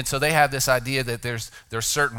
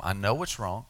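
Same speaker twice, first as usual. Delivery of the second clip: tender; 5 semitones lower, 5 dB quieter than usual. Acted. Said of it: "Jesus explained to him".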